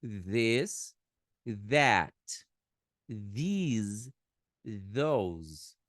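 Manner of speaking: "This, that, these, those" each said separately, with a voiced th; pronunciation drill; English demonstratives. Shown as a demonstration. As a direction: list the four words clearly. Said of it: "ó".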